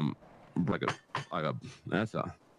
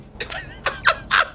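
high burst of real laughter; real laughing fit; 8 dB louder than usual. Real, not acted. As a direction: crack up for real. Breaks into laughter.

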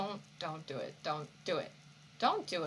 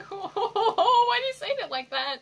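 high laughing question, slowed; 12 semitones higher, 8 dB louder, slower than usual. Real, not acted. Asking the character on screen, laughing. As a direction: laugh through the words.

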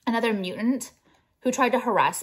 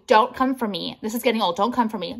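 ordinary talk

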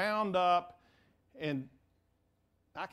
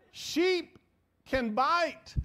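much higher, louder sheep; emphatic punchline; 8 semitones higher, 6 dB louder, much slower than usual. Real, not acted. Preacher stresses that sheep can bite, loud. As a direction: belt out the word sheep.

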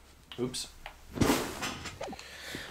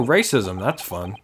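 ordinary talk, nothing marked

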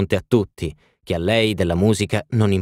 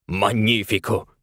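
hearty exclamation. A narrator's reading. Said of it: "esclamò Sir Thomas".

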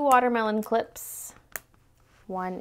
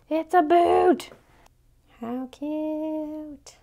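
high, slow cooing; 6 semitones higher, much slower than usual. Real, not acted. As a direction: coo over the tiny boot. Cooing over boot pen.

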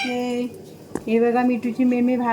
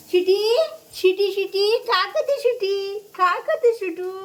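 calling out; 6 semitones higher than usual. Real, not acted. Mother calls dog Sweety asking what she does, high call.

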